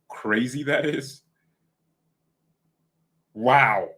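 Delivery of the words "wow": ordinary talk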